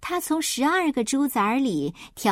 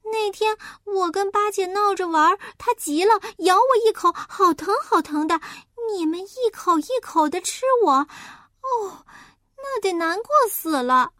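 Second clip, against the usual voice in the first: high, loud, lively little pig; a piglet telling about his mother; chatty piglet; 9 semitones higher, 3 dB louder than usual. Acted. Piglet chatters to the wolf about his mother, high.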